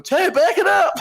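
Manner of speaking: high, loud panic breaking into laughter; yelling it up high while cracking up; panicked laughing shout. Retold or imitated. Guy with the firework, suddenly panicking, laughing shout. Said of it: "and then he starts going".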